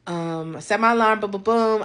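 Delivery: ordinary talk, nothing marked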